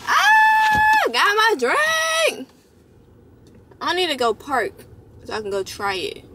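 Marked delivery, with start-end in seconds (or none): 0.1-1.0 a sudden high delighted squeal; excited squeal. Real, not acted. Vlogger squealing at her new drink. 1.1-2.5 high, slow, stretched-out cheer; excited shout. Real, not acted. Vlogger cheering to viewers about her drink.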